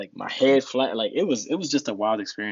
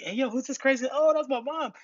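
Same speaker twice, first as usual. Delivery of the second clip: onlookers' reaction. Retold or imitated. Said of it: "I'm like".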